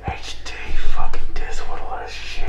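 unvoiced sneering whisper; scornful whispered mutter; whispered aside. Real, not acted. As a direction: hiss it under your breath in disgust.

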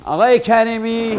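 high urgent call, fast. Acted. Stranded man calling for Mr. Karimi, loud. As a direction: call across the place to find him.

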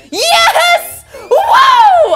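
high, loud victory whoop; triumphant shout. Real, not acted. Gamer whooping after clearing the level. Cheers at his success.

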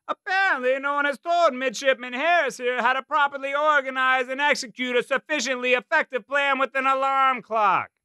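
barking Brooklyn drill sergeant; high, loud drill sergeant yelling. A narrator's reading. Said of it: "Gunnery Sergeant Ramirez's thick Brooklyn accent deepened his bark".

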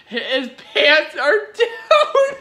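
slow, laughing through the words; real giggle fit. Real, not acted. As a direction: barely get it out for laughing. Laughing at the game scene.

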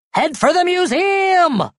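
high, drawn-out holler; slow, high-pitched yell; rallying shout. A narrator's reading. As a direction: call the gang to run for it. Rallying the fleeing group.

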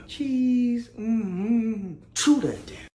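ordinary delivery

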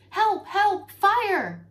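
high, loud panicked screams; voiced screams of a trapped tree; screaming for help. A narrator's reading. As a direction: shout for help as the fire closes in. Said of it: "heard screams".

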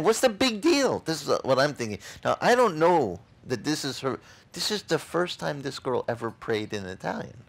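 normal talking voice

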